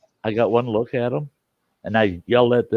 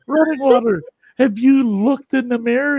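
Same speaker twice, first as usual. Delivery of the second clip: high-pitched mock amusement; retold amused ex-wife; 12 semitones higher, 3 dB louder than usual. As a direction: imitate her cracking up, pitched way up. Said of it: "She burst out freaking laughing".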